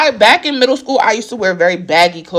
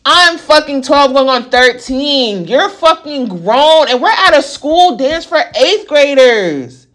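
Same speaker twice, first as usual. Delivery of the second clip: outraged; 6 semitones higher, slower than usual. Real, not acted. Outraged, slow emphatic.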